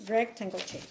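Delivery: ordinary talk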